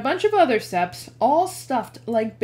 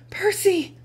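faltering voice; 11 semitones higher, 3 dB louder than usual. Acted. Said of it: "Her voice faltered".

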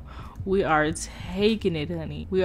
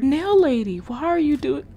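usual speaking voice

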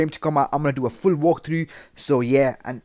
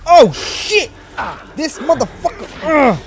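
high, loud shriek into a groan; alarmed shouting; 13 semitones higher, 9 dB louder, slower than usual. Real, not acted. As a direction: shout out in frustrated fright. Found by attacker in game.

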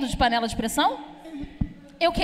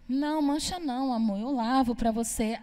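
reassuring wife; 3 semitones lower, 6 dB quieter than usual. Retold or imitated.

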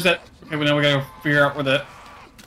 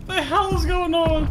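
shocked; 10 semitones higher, slower than usual. Real, not acted. Swarmed by dogs.

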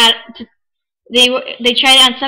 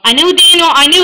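embarrassed excitement; 5 semitones higher, 7 dB louder than usual. Real, not acted. Embarrassed at wrong name.

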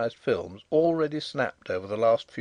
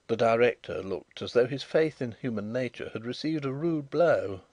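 ordinary delivery

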